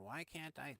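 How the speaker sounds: mildly exasperated mock protest; mock freaking out. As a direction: voice a frustrated player's complaint, lightly. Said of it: "you're gonna be freaking out going".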